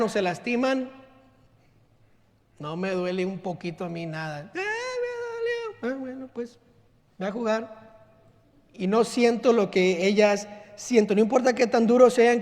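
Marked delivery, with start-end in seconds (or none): 4.5-8.0 offhand, softer shrug-off; dismissive dad. Retold or imitated. Father dismissing his daughter's scraped knee, offhand. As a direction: brush off the child's pain lightly. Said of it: "dad brushing off hurt daughter".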